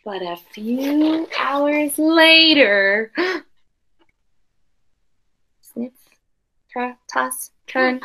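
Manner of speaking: put-on expressive read-aloud for children; storybook reading voice; story narration. A narrator's reading. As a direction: read the picture book to the class with care. Teacher reads picture book aloud.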